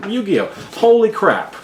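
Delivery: sudden high excited exclamation; excited outburst. Real, not acted. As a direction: blurt it out, thrilled. Excited at box contents.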